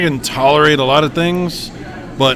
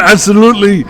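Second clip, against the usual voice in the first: put-on Arnold accent; 4 semitones higher, 8 dB louder, faster than usual. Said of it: "Does he say it like that in the movie?".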